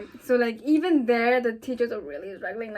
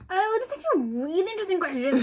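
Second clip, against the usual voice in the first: quick, higher, breezy dodge; mock evasive; 8 semitones higher, faster than usual. Dodging people who ask what her name means, breezily. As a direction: brush the question off brightly and fast. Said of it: "And I'm like".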